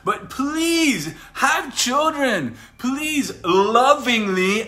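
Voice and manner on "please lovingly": lovingly drawn out slowly, loud and rising; stress on lovingly. Real, not acted. Preacher urging viewers into politics, leaning on lovingly. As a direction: lean hard on lovingly, pleading. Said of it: "and I stress lovingly".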